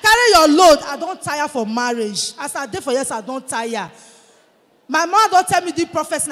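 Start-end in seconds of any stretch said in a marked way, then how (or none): 0.0-0.8 loud call. Acted. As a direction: carry your load called out loud. Loud proclaimed line.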